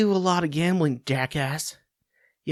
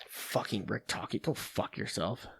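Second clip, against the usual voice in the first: muttered curse; 7 semitones lower, 16 dB quieter than usual. Real, not acted. Cursing at coach under breath.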